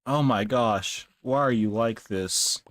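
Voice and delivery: normal talking voice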